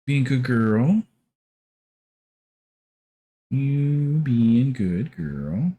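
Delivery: slow, sing-song pet voice. Real, not acted. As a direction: coo at a dog slowly. Talking sweetly to a pet dog.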